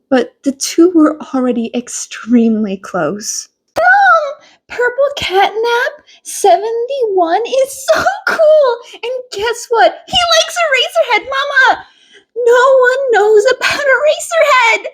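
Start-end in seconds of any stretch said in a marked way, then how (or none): 3.8-14.9 put-on much higher, fast, gushing child's voice; boy gushing to his mother about a friend; excited child. A narrator's reading. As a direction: bubble over like a thrilled kid.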